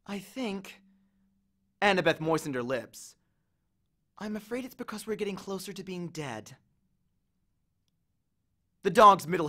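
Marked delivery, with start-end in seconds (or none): 0.1-6.6 soft, frightened near-whisper; hushed fear. Acted. Annabeth quietly admits they are nearly dead, hushed and afraid. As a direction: barely voice the dread.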